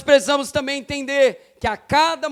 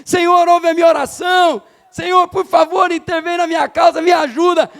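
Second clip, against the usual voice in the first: high, urgent cry to God; raised-voice plea; 4 semitones higher, 4 dB louder than usual. Acted. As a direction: call out for help in acted prayer. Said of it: "você pode erguer a sua voz e dizer". (súplica em voz alta, clamando a Deus)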